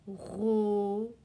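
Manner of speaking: "Robot" drawn out slowly, a pause between syllables; "Robot" stretched syllable by syllable for learners; split into syllables. Shown as a demonstration. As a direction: sound out "Robot" as ro, then bo. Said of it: "c'est la syllabe".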